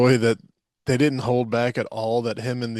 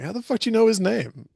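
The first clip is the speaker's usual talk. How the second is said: mock disbelief; 11 semitones higher than usual. Mock outraged question.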